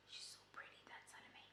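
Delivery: barely audible admiring whisper; whispered aside. Real, not acted. Vlogger privately admiring her crush Salome, whispering.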